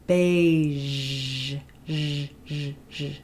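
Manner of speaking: "beige" then buzzing "zh"; zh demo. Shown as a demonstration. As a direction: repeat a voiced "zh". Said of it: "So something like".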